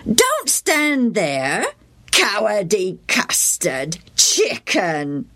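drawn-out, slow mocking boys' voices; slow jeering taunt. A narrator's reading. The boys jeering Joe from the other side, slow and mocking. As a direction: drag the insult out to sting.

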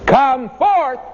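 preacher hinting at a shouted "Come forth"; imagined shout. Retold or imitated. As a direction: call "Come forth" out high, as if across hills, but held back. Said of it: "would have shouted out across those hills".

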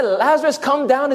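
high, scoffing call to heaven; mock wrong call. Preacher mocking the idea Jesus called Lazarus down from heaven. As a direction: poke fun at the wrong version. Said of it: "Did Jesus say".

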